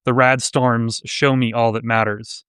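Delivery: measured poetry recital, pauses after each phrase; poem reading. Real, not acted. Host reads a submitted Fallout-inspired poem to livestream audience, poem reading. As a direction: read it as verse, lowering at line ends.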